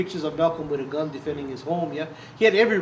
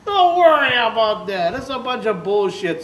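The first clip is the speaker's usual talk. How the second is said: put-on louder, slow, higher dismissive old man; George brushing it off; 6 semitones higher, 8 dB louder, slower than usual. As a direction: wave the worry away, gruffly. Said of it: "He goes".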